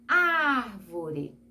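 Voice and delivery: "Árvore" with a long, drawn-out "Ár"; stressed first syllable. Shown as a demonstration. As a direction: stretch the opening "Ár" of "Árvore", then let the rest drop. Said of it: "antepenúltima sílaba mais forte". ("Árvore" alongando bem a primeira sílaba, "Ár")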